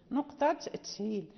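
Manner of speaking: ordinary delivery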